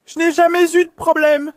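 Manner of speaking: high, loud tearful plea; desperate pleading. Real, not acted. Suspect told he is going to prison, desperate pleading. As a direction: beg at full voice.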